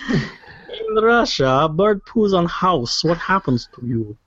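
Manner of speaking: slow, high-starting mock Russian accent; drawn-out, hammed-up foreign accent; muddled fake accent. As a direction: pose the question in a badly faked Russian accent. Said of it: "I don't know what accent I'm doing".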